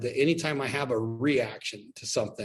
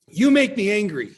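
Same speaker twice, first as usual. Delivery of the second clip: higher, louder parental scolding; imitating an angry parent; 8 semitones higher, 7 dB louder than usual. Imitating a parent blaming the kid for their anger, loud. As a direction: snap it like a fed-up parent.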